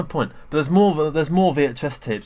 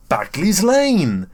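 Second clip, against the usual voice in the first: slow, higher, louder sing-song stop call; hammed-up bus driver announcement; enthusiastic announcer; 6 semitones higher, 7 dB louder, much slower than usual. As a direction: belt the stop name like a delighted driver. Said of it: "he'll have that really enthusiastic tone".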